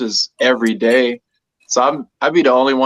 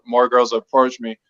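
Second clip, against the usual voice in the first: ordinary delivery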